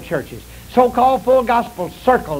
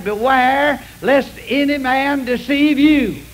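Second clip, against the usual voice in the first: slow, raised solemn warning; slow scripture quote; 4 semitones higher, slower than usual. Acted. Preacher warning his listeners with Jesus' words, slow and solemn. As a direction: weigh every word of the warning.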